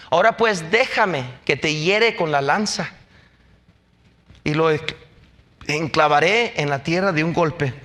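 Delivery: measured, even reading of a Bible passage; calm sermon reading voice; scripture reading. Acted. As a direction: read the verses steadily to the congregation. Reads scripture aloud.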